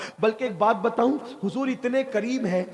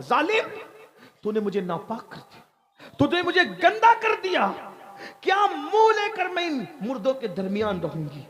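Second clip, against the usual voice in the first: screamed accusation; 6 semitones higher than usual. A narrator's reading. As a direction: cry out at the wrongdoer, voice leaping up high. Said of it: "उसने चीख मारी". (चीखकर ज़ालिम को धिक्कारना)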